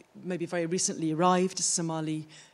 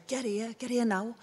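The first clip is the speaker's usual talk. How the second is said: higher Liverpool-accented call; Scouse accent; 5 semitones higher than usual. Shown as a demonstration. Showing how Liverpool mothers call kids, in Scouse. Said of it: "in Liverpool, they'll say".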